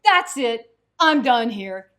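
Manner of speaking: loud, exasperated outburst; student shouting that he quits; fed-up student. Retold or imitated. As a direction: storm off with a shout. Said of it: "the young student was flabbergasted".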